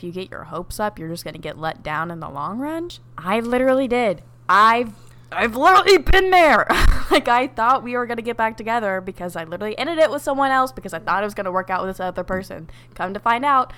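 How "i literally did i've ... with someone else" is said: loud, high, laughing admission; excited laughing outburst. Real, not acted. Telling a friend she's been there, loud and laughing. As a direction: blurt it out between laughs.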